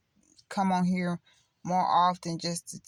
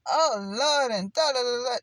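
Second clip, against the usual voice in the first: mock showy prayer; 4 semitones higher, 4 dB louder than usual. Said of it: "When y'all be".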